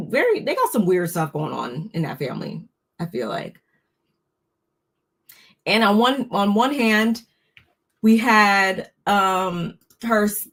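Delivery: ordinary talk, nothing marked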